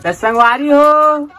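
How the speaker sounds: slow, high sing-song greeting; vlogger greeting. Real, not acted. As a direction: stretch out the hello to viewers. Vlog greeting drawn out. (धीमा, ऊँचा खिंचा हुआ अभिवादन)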